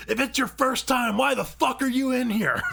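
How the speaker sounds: high, loud imitated rage; angry shouting. Angry teammate berating a first-timer in a dungeon, shouting. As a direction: yell it like a furious gamer. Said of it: "whole fucking shouting match".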